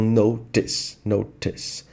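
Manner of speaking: British "notice" with the T fully sounded; British true T. Shown as a demonstration. Teacher drilling the British version, "notice" with true T. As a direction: say "notice" with a real T, no flap, British-style. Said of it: "ele tem um som de T mesmo".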